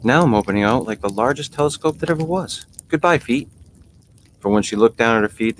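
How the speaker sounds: put-on a child marvelling at her own feet; lightly voiced little girl Alice; young Alice voice. A narrator's reading.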